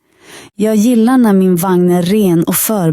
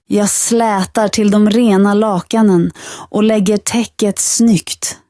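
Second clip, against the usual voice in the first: ordinary delivery, nothing marked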